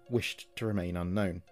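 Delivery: ordinary delivery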